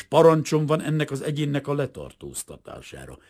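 plain reading voice

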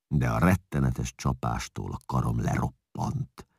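plain reading voice

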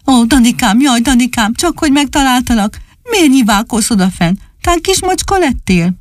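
high, fussing puppy voice; little dog voice. Acted. Csupi finds Dani up in the tree, high relieved fussing.

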